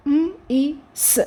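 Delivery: "మ్, ఈ, స్" as three separate sounds; sounded out. Shown as a demonstration. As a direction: spell "మ్, ఈ, స్" by sound, m, ee, s. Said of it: "week సౌండ్స్కి ఒక vowel sound".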